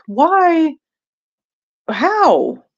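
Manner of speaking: high, baffled why and how; mock outraged disbelief; incredulous. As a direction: disbelief at the tax bill. Exasperated quoting own tax shock.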